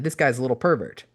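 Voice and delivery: ordinary delivery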